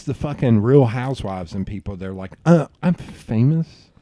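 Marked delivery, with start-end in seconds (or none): 2.4-3.9 slow, higher mock-entitled voice; mock a would-be celebrity; drawn-out "don't you know me" attitude; self-important. Mocking self-important reality stars.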